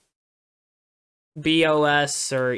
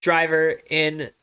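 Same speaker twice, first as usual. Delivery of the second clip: slow, laboured title read; slower than usual. Shown as a demonstration. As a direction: draw out every word of the title. Reads long title slowly to mock it.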